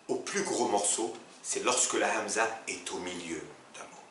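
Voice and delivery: ordinary talk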